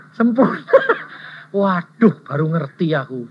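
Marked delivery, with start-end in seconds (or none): 0.6-1.3 high laugh. Real, not acted. Punchline laughter. (tawa tinggi)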